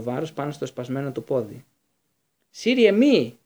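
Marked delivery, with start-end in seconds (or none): 2.5-3.4 urgent cry, much higher and louder; shout. A narrator's reading. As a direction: yell to stop him, full force. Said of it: "φώναξε ο Λούπιν". (δυνατή, ψηλή κραυγή να τον σταματήσει)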